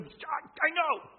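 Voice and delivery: eager, high, loud blurt; alumno ansioso. Acted. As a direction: jump in like the kid who knows it. Peter as eager pupil jumping up.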